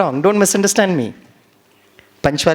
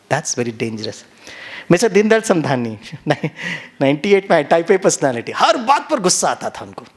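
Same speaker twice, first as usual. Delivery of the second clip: normal talking voice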